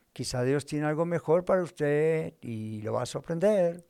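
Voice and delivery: ordinary delivery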